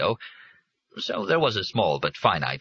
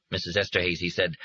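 ordinary delivery

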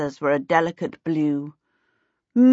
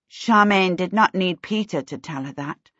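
usual speaking voice

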